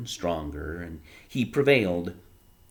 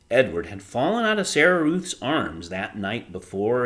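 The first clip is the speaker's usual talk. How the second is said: plain reading voice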